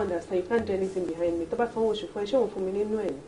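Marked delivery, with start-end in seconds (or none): none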